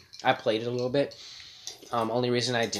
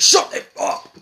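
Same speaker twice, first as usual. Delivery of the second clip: shouting colonel, high and loud; angry yell; 8 dB louder, slower than usual. Pretend. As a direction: bark it like a furious officer. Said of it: "Your colonel screams at the mom".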